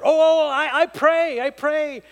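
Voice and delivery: loud, high, stammering imitation; mock flustered eagerness. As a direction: hurriedly insist to the pastor, hammed-up. Stranger reacting hastily to pastor.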